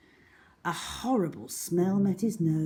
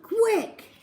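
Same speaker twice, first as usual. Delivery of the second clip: much higher urgent cry; boy urging escape; urgent yell; 4 dB louder than usual. A narrator's reading. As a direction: hurry her. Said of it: "he yelled".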